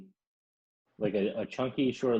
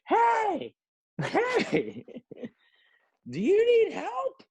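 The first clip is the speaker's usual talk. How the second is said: very high, loud mock shout of an older lady; hammed-up high-pitched lady calling out from her truck; shrill New Yorker shout; 4 dB louder, much slower than usual. Older lady yelling from her truck at the stranded narrator, high mock shout. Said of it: "And she's like".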